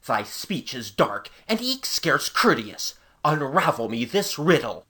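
slow, lordly archaic knight; measured, haughty medieval command. A narrator's reading. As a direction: demand an answer with a knight's hauteur. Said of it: "asked Sir Wulfric haughtily".

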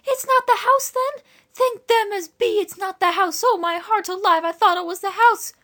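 very high, loud country woman's voice; panting country woman. A narrator's reading. Woman relieved it's not the house, high panting exclamation.

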